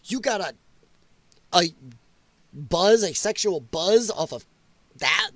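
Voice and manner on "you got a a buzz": normal talking voice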